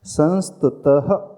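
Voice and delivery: slow, with the visarga breathed out at the end of संस्तुतः; visarga pronunciation. Shown as a demonstration. Teacher showing students how संस्तुतः ends. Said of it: "ऐसा उच्चारण करना".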